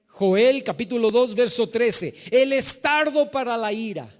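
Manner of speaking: loud, drawn-out sermon cadence; full-force preaching. Acted. Pastor proclaiming God's mercy to the congregation, emphatic preaching. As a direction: preach it, stretching the key words.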